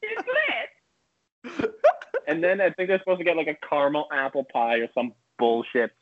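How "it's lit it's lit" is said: mock ad lib, much higher and softer. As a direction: toss off a rapper's ad lib, high and airy. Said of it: "It's just his fucking ad libs".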